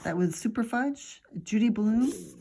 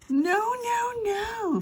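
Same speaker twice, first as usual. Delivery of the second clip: shouted; much slower than usual. A narrator's reading. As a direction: squeal no like a scared kid. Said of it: "Fudge shouted".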